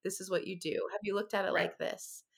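low, soft, steadying voice; gentle reassurance. Retold or imitated. Friend stepping in for a struggling mother, soft reassurance. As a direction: talk them down softly.

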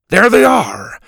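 high, loud call with drawn-out words. A narrator's reading. Grandpa pointing out the ships ahead, calling out.

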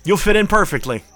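loud high cheer; silly clown. Acted. Host cheering loudly.

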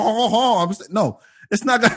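ordinary delivery

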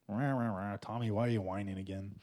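low, quiet mock grumble; soft, low self-mocking grouse; mock grumbling. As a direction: grumble under your breath, sending up your own whining. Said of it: "Anyone else been grumbling like me?".

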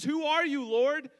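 high, loud, startled Who are you, Lord; startled question. Acted. Saul, struck down, asks the voice who it is. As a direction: cry out in alarm at the unseen voice.

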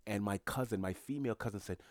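normal talking voice

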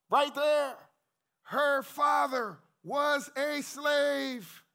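high, loud, slow proclamation; preaching shout. Real, not acted. Preacher telling the congregation her father was a slave, shouting. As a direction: belt it out slowly to the congregation.

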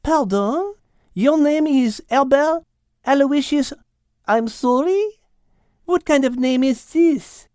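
put-on high French-accented cat, questioning; Mademoiselle, doubtful. Cat doubting the stranger's name, high questioning French accent. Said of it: "Mademoiselle looked at Al with doubt".

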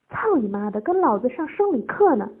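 high, loud angry demand; loud shouting. A narrator's reading. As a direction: shout the order impatiently. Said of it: "陈三大声喊道".

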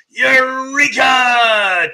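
long, drawn-out high shout; triumphant, loud, elongated exclamation; exultant cry. Acted. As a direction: shout it like a big discovery, stretched right out. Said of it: "I thought that was like".

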